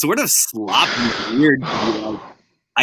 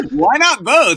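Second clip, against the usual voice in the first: lightly acted ad line, higher and drawn out; taco commercial catchphrase; 10 semitones higher, 5 dB louder, slower than usual. Riffing on a taco commercial for co-hosts, lightly acted catchphrase. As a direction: pitch it up like a quoted commercial tagline. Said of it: "that taco commercial".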